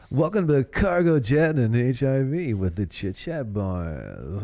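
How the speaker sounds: slow, drawn-out show announcer; podcast host intro. Pretend. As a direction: welcome listeners like a radio host.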